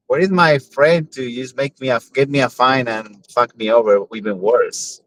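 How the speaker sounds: usual speaking voice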